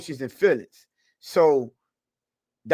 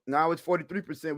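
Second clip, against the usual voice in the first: ordinary talk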